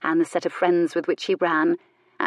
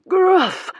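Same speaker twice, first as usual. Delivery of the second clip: mock dog bark; 13 semitones higher, 6 dB louder than usual. A narrator's reading.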